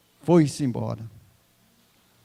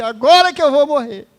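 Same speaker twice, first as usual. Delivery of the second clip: higher, louder panicked cry on "agora"; retold panicked cry; 6 semitones higher, 11 dB louder than usual. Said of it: "eu comecei a gritar e chorar". (grito desesperado, mais alto e mais agudo)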